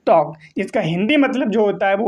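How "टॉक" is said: टॉक pronounced tawk, silent l; teacher's model word. Shown as a demonstration. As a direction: model टॉक for students to repeat. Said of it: "क्या होता है?".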